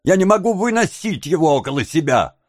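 high-pitched, embittered tirade; bitter outburst. A narrator's reading. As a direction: pour out hatred, high and heated.

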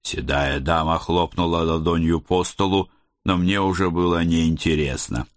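ordinary delivery

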